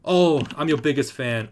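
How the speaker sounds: squealing, high-pitched starstruck fan; excited fangirl. Pretend. Fangirling over game character cosplay.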